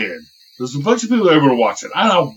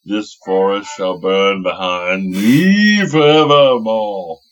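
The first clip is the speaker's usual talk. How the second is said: low, slow dragon proclamation; fire-breathing dragon; 6 semitones lower, slower than usual. Pretend. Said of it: "fire dragon voice here".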